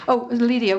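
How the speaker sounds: ordinary delivery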